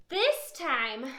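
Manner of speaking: ordinary delivery, nothing marked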